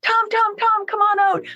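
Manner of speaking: very high, urgent re-enacted scream; imitated high-pitched holler; screaming for husband. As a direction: call out frantically to someone in another room. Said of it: "I'm screaming for my husband".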